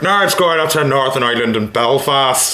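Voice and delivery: lightly done Northern Irish sign-off; Northern Irish accent. Acted. Guest ending the podcast episode in Northern Irish accent. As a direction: close the show like a Belfast host. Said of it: "That's a good Irish accent".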